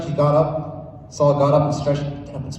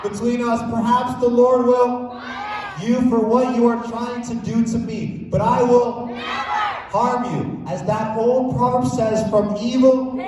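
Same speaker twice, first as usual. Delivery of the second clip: slow, raised proclamation; higher, slower solemn reading; declaiming the verse; 8 semitones higher, slower than usual. Acted. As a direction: proclaim it like scripture to the king. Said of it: "the verse that David said".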